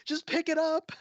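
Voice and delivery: high-pitched imitation of frantic players; mock screaming. Players on voice chat yelling at each other, mock screaming. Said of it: "they're all screaming".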